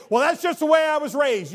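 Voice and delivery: a lightly hammed-up shrug of an excuse; mocking excuse. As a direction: parrot the lame excuse. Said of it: "Don't pass it off and say".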